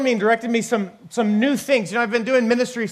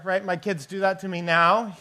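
ordinary talk, nothing marked

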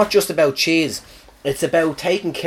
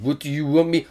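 put-on French accent. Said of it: "That's my French accent".

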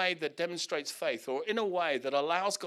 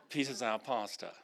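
ordinary delivery

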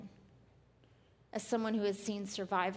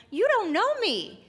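much higher, louder playful threat, drawn out; mock warning; 13 semitones higher, 10 dB louder, slower than usual. Preacher joking to congregation about her temper, loud mock warning. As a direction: warn them with hammed-up menace.

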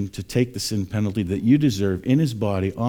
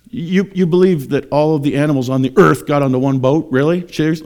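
louder, higher, scornful incredulity; duh, mocking disbelief; 5 semitones higher, 7 dB louder than usual. Scoffer questioning all animals on one boat, mocking disbelief. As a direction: question it as if only a fool believes it. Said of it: "Like, kinda like, "Duh, you're so stupid".

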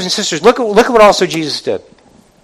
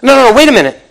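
louder, higher hammed-up pleading; mock backpedaling; 6 semitones higher, 4 dB louder than usual. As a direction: back out of it in a hurry. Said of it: "They say".

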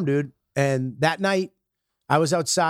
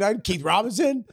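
ordinary talk

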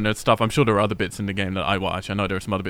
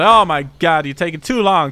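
loud impatient complaint; 5 semitones higher, 6 dB louder than usual. Retold or imitated.

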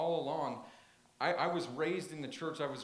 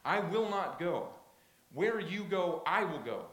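loud, raised, pleading declaration; Ruth's impassioned vow; 3 semitones higher, 6 dB louder than usual. Acted. Preacher voicing Ruth vowing to Naomi, loud and earnest. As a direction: voice Ruth swearing loyalty with full force. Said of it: "Ruth makes this stunning statement".

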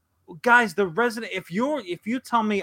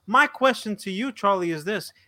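normal talking voice

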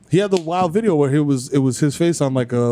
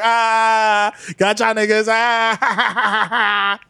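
laughing, high mocking gotcha; gleeful gotcha; 7 semitones higher, much slower than usual. Springing the prank on his friends, laughing and gleeful. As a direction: crow it through a laugh. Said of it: "I was like".

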